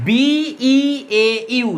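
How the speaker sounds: letters of বিইএইউ drawn out one by one; spelled out slowly. Shown as a demonstration. Teacher spelling a French word for students, very slowly. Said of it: "শেষে এ ইউ আছে".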